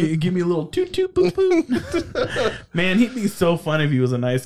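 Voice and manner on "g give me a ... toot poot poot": silly high voice, laughing; Putin. Pretend. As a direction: play with the name in a goofy high lilt. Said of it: "I wanna hear Putin".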